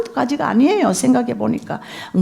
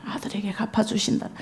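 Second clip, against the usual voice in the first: whisper; 12 dB quieter than usual. Real, not acted. Quiet repeat of the point.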